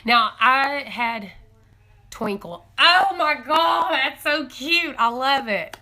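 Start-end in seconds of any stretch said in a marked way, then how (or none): 2.8-5.8 high, loud squeal over a cute chicken; real delight, pitched up and belted; excited gush. Real, not acted. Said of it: "now I'm all excited".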